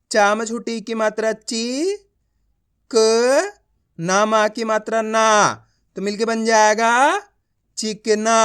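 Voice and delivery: ची, क, ना given singly with pauses, then चिकना joined; measured classroom drill building up चिकना; letter-by-letter reading. Shown as a demonstration. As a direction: sound out each piece of चिकना before blending. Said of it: "आप इस तरीके से उच्चारण करोगे".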